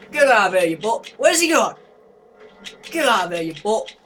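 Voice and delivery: loud high shout at the monster; defiant shout. Real, not acted. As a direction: yell to chase it off. Shooing monster in game.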